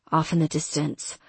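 usual speaking voice

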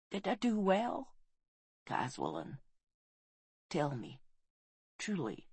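very soft, faint questioning; hushed and weak, nearly gone; fading dying voice. A narrator's reading. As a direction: plead quietly with last strength. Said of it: "the woman was fading fast".

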